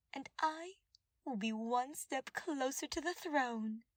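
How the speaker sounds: the advisor boasts of seizing the throne, triumphant; scheming advisor. Acted. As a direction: savor every word of the victory. Villain advisor voiced in story.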